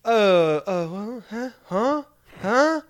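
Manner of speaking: high, rising puzzled huhs, lightly voiced; mock confused Frenchwoman. Imitating a French woman baffled by a tourist, mock confusion. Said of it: "she was like".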